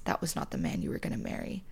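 hushed, fast whisper; confiding whisper to a heartbroken friend. Real, not acted. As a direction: lean in and whisper it. Hushed emphatic aside.

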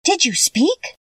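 put-on high-pitched, astonished girl's question; wondering. A narrator's reading. Girl addresses a scarecrow that spoke, in wonder. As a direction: ask in wonder, voice lifting high. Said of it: "asked the girl in wonder".

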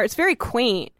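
ordinary delivery

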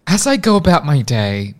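confident keynote presenter voice; TED talk speech. Acted. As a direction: pitch it like a polished keynote. Delivers TED talk pitch.

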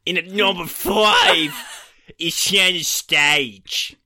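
a bad American accent, hammed up and slow. Ranking read to his co-host in a bad American accent. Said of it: "So I'll just do my American accent then".

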